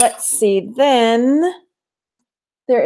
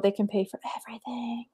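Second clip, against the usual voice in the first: whispering; 13 dB quieter, faster than usual. Real, not acted. Hoping the jars hold gold.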